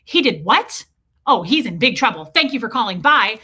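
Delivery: loud, exaggerated shock, then brisk sign-off; mock compliant parent. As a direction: play up the outraged, obedient parent loudly. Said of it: "schools expect the parent to be like".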